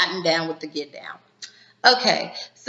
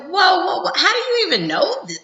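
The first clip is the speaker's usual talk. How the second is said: much higher, louder startled whoas; shocked whoa; 12 semitones higher, 4 dB louder than usual. Retold or imitated. Jim caught off guard by Catherine, higher and louder in shock. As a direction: blurt it out startled, pitch jumping way up.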